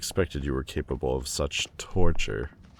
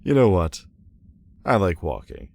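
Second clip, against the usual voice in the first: louder, higher burst of decision; sudden brisk resolve; 3 semitones higher, 6 dB louder than usual. Acted. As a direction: cover the awkward moment with sudden pep. Covering failure with sudden cheer.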